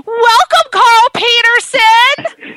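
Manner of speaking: high, drawn-out guest introduction; talk-show intro, name stretched out; show host welcome. Real, not acted. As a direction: announce the guest with a big flourish.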